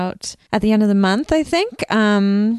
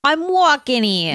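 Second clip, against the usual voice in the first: higher, hammed-up New Yorker; mock New York accent; 6 semitones higher than usual. Host obliging an accent lover with a hammed-up New York accent. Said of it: "I love a New York accent".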